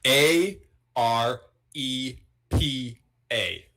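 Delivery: slow, letter by letter; spelled out. Shown as a demonstration. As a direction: spell each letter out deliberately. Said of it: "spelling it out slowly".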